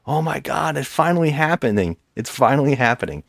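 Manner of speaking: a father gushing that his moment has come; mock excitement. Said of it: "And the father goes".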